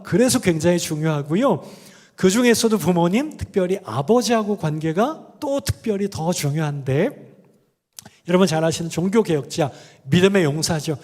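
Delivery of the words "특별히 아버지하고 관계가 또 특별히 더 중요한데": ordinary talk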